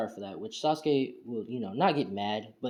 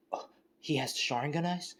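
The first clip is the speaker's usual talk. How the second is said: higher, rising question in mild shock; acted shock; 4 semitones higher than usual. Fugaku seeing Naruto's Sharingan eyes, acted shock. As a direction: play mild stunned disbelief, pitched up. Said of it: "would be shocked and be like".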